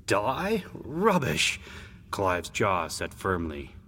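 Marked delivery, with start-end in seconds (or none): 0.1-1.6 lightly acted disbelief, pitched up; scoffing big brother. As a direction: scoff at your little brother's fear.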